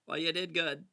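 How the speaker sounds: ordinary talk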